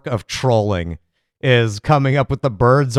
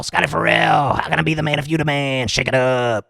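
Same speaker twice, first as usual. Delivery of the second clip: Scotty Farrell impression.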